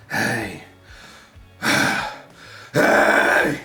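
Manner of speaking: breathy airy rasp, gently; light rasping sigh. Shown as a demonstration. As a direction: sigh it out with grit. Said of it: "just do the light sighs".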